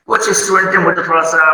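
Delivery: normal talking voice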